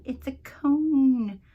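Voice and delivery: high, loud, drawn-out movie line; movie quote. Acted. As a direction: stretch out CONE like a quoted punchline. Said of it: "from The Wedding Singer".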